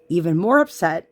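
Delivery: ordinary delivery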